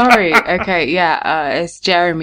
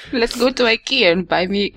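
put-on higher voice in a muddled European accent; muddled foreign accent; 6 semitones higher than usual. Co-host proposing an Ikea trip, muddled foreign accent. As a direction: put on a badly faked, unplaceable accent. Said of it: "I don't know what accent I'm doing".

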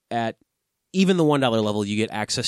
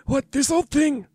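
Voldemort voice; 14 semitones higher than usual. Acted. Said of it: "The Voldemort voice".

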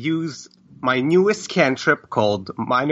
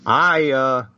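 normal talking voice

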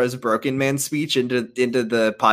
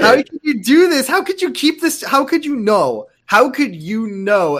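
outraged disbelief; 10 semitones higher than usual. Acted. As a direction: shriek in mock betrayal. Mock betrayed shriek.

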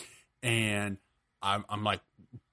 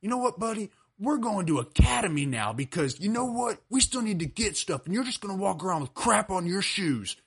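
high, strained voice of an angry father; scolding dad; 10 semitones higher than usual. Retold or imitated. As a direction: lay down the punishment as an exasperated dad. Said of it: "My dad said".

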